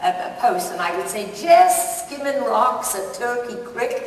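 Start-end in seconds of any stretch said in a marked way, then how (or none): 1.3-4.1 slow, higher, louder Southern drawl; bad Southern belle. Shown as a demonstration. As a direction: ham up a terrible Southern belle accent. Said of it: "my southern accent, my southern belle, was about as bad".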